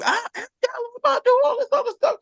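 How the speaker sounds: a squeaky mock of the caught dude; high mocking voice. Squeaking high as the guard grabs him. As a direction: jump way up high and whiny.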